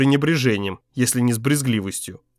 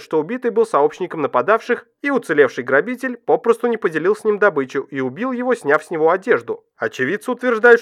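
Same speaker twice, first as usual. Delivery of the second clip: higher, brisk newsreader delivery; news read; 7 semitones higher than usual. A narrator's reading.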